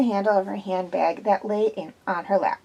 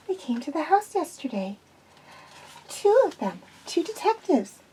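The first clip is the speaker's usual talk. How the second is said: lightly raised, anxious woman's voice; nervous young woman; 7 semitones higher than usual. A narrator's reading. Zoe tells Quillian she is nervous, lightly voiced anxious woman. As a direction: play Zoe uneasy and jumpy.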